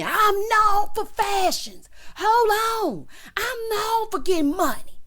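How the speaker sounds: high, loud, slow showy imitation; mock boast. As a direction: ham up a diva's bragging. Mocking Marlo's Africa boast.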